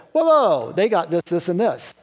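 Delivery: mock protest at unfair treatment; mock outrage. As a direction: burst in with a jealous whoa.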